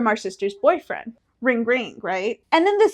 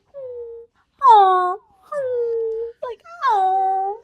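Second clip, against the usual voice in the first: mom's cooing noise; 10 semitones higher, much slower than usual. Retold or imitated. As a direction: imitate a touched mother cooing. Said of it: "that noise she makes when she's like".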